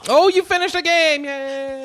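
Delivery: high loud delighted yay; excited cheer. Real, not acted. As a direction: cheer her on. Cheering wife.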